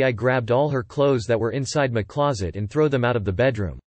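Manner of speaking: ordinary delivery, nothing marked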